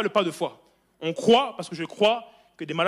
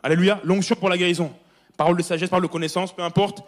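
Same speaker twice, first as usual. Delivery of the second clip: full-force preaching; 6 dB louder than usual. Acted. Preacher to congregation about Paul, loud fervent preaching. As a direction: proclaim it to the back row.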